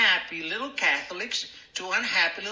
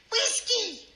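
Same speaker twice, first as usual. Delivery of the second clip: very high-pitched, showy squeal of an order; practised drink order. Retold or imitated. Newcomer practises ordering in the mirror, in a very high put-on voice. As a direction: overdo a rehearsed bar order, pitched way up. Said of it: "I wanted to sound properly".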